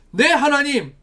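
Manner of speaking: eager answer. Acted. Modelling an eager answer to God.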